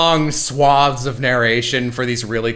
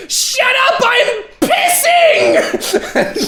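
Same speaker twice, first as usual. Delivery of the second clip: very high, drawn-out yell; frantic mock shout; 3 dB louder, much slower than usual. Actress sprinting past colleagues in the lobby, frantic high mock shout. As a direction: yell it sky-high, stretching the last word.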